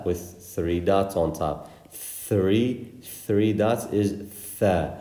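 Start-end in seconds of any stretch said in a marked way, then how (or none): none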